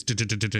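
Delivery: rapid repeated "duh" noises; imitated kick effect. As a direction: mimic a movie kick with a string of duhs. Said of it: "the effects, like when".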